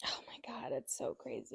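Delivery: barely audible, stunned disbelief; horrified whisper. Real, not acted. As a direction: whisper it under your breath, appalled. Horrified reaction to birth story.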